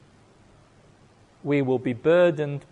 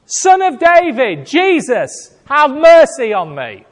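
high, loud desperate plea shouted over the crowd; crying out; 9 semitones higher, 10 dB louder than usual. Acted. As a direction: shout it high, begging for mercy. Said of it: "he cried out".

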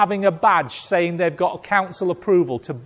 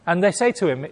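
ordinary talk, nothing marked

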